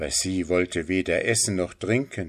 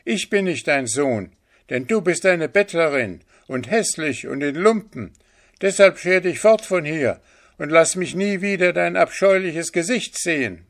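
high, forceful, contemptuous rejection; angry boy; 9 semitones higher, 5 dB louder than usual. A narrator's reading. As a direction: drive the beggar away angrily. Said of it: "rief das Sternenkind zornig". (zornig und verächtlich, hohe Stimme)

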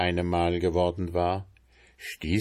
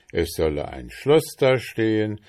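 ordinary delivery

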